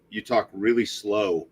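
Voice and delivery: slow, deliberate pace on the words; drawn-out slow. Retold or imitated. New Yorkers remarking on the speaker's talk, drawn-out slow. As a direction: say it really slowly, stretching each word. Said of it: "the other thing they said is".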